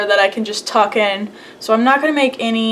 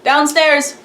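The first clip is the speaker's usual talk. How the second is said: yelling across the room; 4 semitones higher than usual. Real, not acted. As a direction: shout it down the stairs. Repeats answer to another room.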